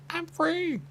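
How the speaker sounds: very high, loud exclamation; squeaky free. Acted. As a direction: shoot up into a squeak of joy. Quoted figure freed from Lego.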